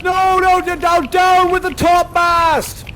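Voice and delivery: high, loud shouted commands over the storm; ship's bosun yelling through the gale; bosun shouting orders. Acted. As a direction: bellow orders to the crew in a tempest. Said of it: "I'm gonna be the bosun".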